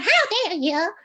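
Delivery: "How dare you?" squeaked high and loud; shrill-sounding high version, the way not to do it; high-pitched wrong take. Shown as a demonstration. Teacher showing the too-high wrong version. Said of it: "it's not high pitch".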